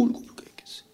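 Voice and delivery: breathy whispered aside. Real, not acted. Preacher imagining Joseph's weeping, whispered. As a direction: drop to a whisper. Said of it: "sudden whisper".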